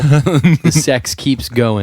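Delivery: slow, drawn-out slogan read. Acted. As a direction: spell it out slowly like a slogan.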